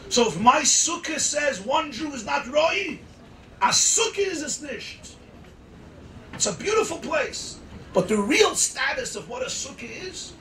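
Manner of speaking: loud, high impassioned sermon; preaching at full force. Acted. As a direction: preach it with rising fire. Preacher climaxes loudly.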